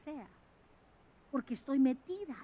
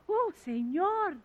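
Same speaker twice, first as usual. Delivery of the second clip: awed sigh; 5 semitones higher, much slower than usual. Retold or imitated. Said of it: "y digo".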